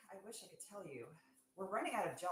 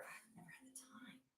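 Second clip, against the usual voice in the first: weary whisper; 3 semitones higher, 16 dB quieter, faster than usual. Real, not acted. Sigh, tired whisper.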